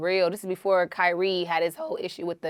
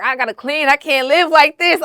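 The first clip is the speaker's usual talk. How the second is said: frustrated exclaim; 8 semitones higher, 11 dB louder than usual. Retold or imitated. Recalling her messy house, loud mock exasperation. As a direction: complain about the dirty house. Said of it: "I'd be like".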